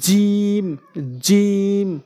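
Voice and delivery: জিম with j from the middle of the tongue, long vowel; held Arabic জিম. Shown as a demonstration. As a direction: draw out জিম as a letter drill. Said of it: "জিমটা হচ্ছে জিহ্বার মাঝখান".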